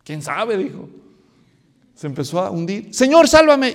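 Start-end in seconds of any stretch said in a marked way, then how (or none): 2.9-3.7 loud, high cry of a sinking man; desperate cry for help. Retold or imitated. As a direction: cry out to be saved. Pedro se hunde otra vez. (grito desesperado de auxilio)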